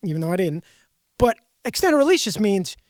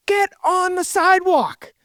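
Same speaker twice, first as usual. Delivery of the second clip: higher, slow parental call; 9 semitones higher, slower than usual. Acted. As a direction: call a kid off the street in a raised pitch. Calling out to kid in street.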